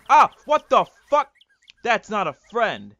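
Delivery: sudden high, loud cry of alarm; shocked yell. Real, not acted. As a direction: yelp as if stung. Attacked by a bee.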